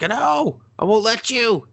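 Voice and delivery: very high, strained acted cry; mock desperate refusal.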